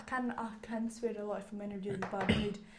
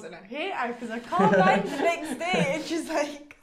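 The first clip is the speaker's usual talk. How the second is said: talking through laughter; laughing; 4 semitones higher, 13 dB louder than usual. Real, not acted. Friend recounting her drunken night out, laughing. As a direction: let laughter bubble through the words.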